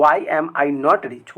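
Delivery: why am I not rich stretched very slowly; slow dictation. Shown as a demonstration. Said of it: "तो". (बहुत धीरे बोलना)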